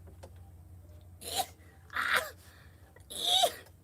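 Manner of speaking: high breathless mock cackle; imitated crazy laugh. Vlogger imitating the preacher's smile, high cackling laugh. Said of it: "She's like".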